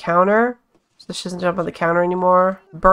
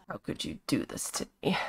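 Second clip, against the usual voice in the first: whispered complaint; 8 semitones lower, 17 dB quieter than usual. Pretend. Voicing the heavily pregnant sim.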